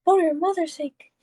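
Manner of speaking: put-on high old woman's plea, not Spanish-accented; high pitched old lady. Shown as a demonstration. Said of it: "came out with Spanish accent".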